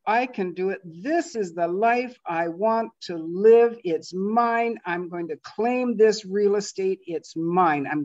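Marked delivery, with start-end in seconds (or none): none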